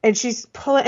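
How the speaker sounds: ordinary delivery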